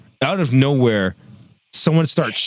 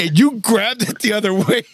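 higher, slowed voice for the friend; buddy explaining; 6 semitones higher than usual. Retold or imitated. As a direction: act out the shaken buddy's account. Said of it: "He's like".

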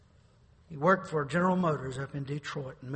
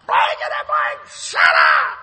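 mock angry retort; 14 dB louder, slower than usual. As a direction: bark him off in disgust. Neighbor cursing again.

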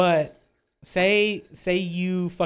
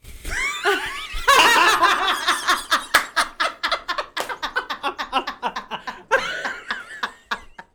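long high shrieking laugh; real uncontrollable cackle; howling laugh; 14 semitones higher than usual. Real, not acted. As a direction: burst into helpless laughter. Group erupts laughing.

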